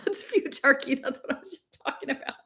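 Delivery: high, laughing, tumbling out quickly; laughing aside. Real, not acted. Reader recognises their own topic in the book, laughing aside. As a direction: break off reading with an excited laugh.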